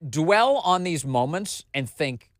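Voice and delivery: ordinary talk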